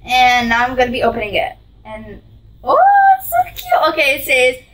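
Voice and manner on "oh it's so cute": very high squeal of delight. Real, not acted. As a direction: squeak it out with joy. Opening card, squeals.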